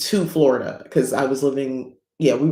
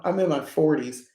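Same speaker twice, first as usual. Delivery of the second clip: ordinary delivery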